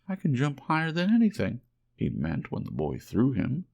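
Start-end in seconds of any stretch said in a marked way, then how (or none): 0.1-1.6 high, fast, louder bragging; boastful little rabbit. A narrator's reading. Little rabbit brags to the wild rabbits, high and fast. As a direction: rush out a high proud boast. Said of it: "said the little rabbit".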